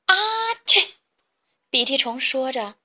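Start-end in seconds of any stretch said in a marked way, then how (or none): 0.1-0.9 a big sneeze; ah-choo, let it burst out; loud sneeze. Acted. Snotty sneezes from his cat allergy. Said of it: "打了个大喷嚏".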